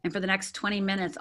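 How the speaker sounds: ordinary delivery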